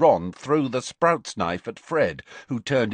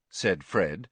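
ordinary delivery, nothing marked

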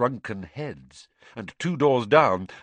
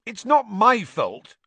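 high, indignant schoolboy retort; sulky retort; 14 semitones higher, 9 dB louder, slower than usual. A narrator's reading. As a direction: snap back in a whiny protest. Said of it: "retorted Draco".

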